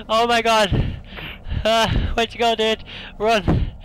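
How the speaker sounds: high, loud, slow terrified cry; panicked shout. Real, not acted. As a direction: yell in real panic as something chases you. Chased by monster in game.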